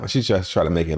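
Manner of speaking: ordinary delivery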